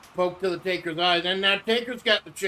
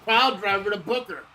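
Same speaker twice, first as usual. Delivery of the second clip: hyped, higher play-by-play call; wrestling commentator; 5 semitones higher, 3 dB louder than usual. Acted. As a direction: ringside announcer building excitement. Live play-by-play of the match.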